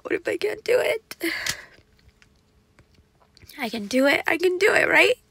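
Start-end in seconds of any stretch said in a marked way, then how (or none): 0.0-1.2 much higher, whispered through sobs; crying whisper. Real, not acted. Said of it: "We're just crying together today". 4.3-5.2 higher and louder, rising through tears; tearful self-encouragement. Real, not acted. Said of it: "We're just crying together today".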